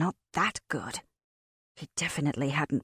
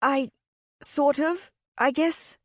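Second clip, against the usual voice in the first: flustered, unsure; 9 semitones higher, slower than usual. A narrator's reading. Flustered girl accused.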